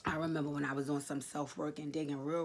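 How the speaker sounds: normal talking voice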